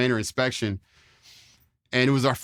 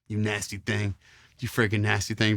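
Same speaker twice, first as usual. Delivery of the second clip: drill instructor sneer; 3 semitones lower, 4 dB quieter than usual. Retold or imitated. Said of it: "he'd be like".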